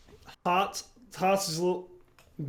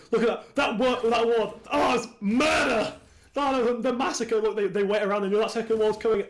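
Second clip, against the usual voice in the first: raised-pitch, amazed caster; excited replay commentary; 6 semitones higher, 3 dB louder than usual. Real, not acted. Caster shows viewers the ward massacre, excited. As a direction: exclaim over the replay, pitched up.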